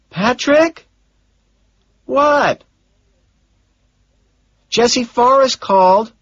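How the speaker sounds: a secretary passing on messages; Gene's voice. Acted. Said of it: "Gene says".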